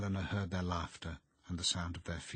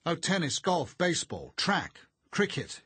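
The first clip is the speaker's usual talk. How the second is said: sly boasting; 11 semitones higher, 9 dB louder than usual. A narrator's reading. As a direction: list them with a sly grin. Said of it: "he smiled slyly".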